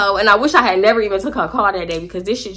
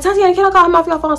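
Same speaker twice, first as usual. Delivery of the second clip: high quoted friend; 9 semitones higher, faster than usual. Retold or imitated. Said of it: "She like".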